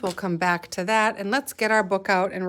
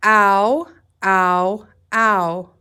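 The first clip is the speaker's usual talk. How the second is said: teacher drilling the ow sound aloud; loud ow sounds; 8 dB louder, much slower than usual. Shown as a demonstration. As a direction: sound chant of ow, pushed out loud. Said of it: "Let's say this sound three times together".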